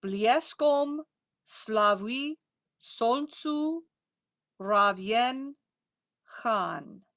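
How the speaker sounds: very slow, drawn-out Russian words; slow pronunciation model. Shown as a demonstration. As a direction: space out each word for repetition. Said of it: "Reading that line again".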